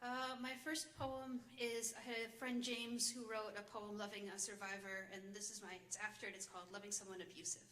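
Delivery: usual speaking voice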